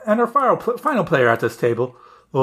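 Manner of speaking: ordinary talk, nothing marked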